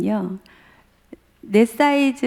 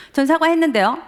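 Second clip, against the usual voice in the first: 전 사과했는데요 said higher and louder, defiant; heated son; 8 semitones higher, 4 dB louder than usual. Retold or imitated. Son snapping back at his father as the fight escalates, heated. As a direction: fire back 사과했는데요 with rising heat. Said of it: "점점 서로 격해".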